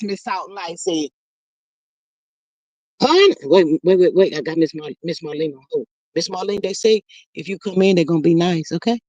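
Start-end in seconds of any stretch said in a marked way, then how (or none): none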